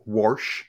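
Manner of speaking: "warsh" with an R before the sh; imitated "warsh", R clearly sounded; added R. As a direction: say "warsh", grandma's Southern R in wash. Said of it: "always put an R in things".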